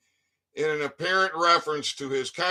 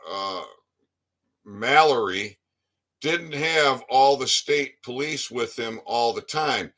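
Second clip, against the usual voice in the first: ordinary talk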